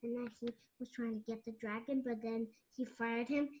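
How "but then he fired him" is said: ordinary delivery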